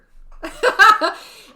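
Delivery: high loud burst of laughter; big high giggle; laughing. Real, not acted. Giggles after the aside.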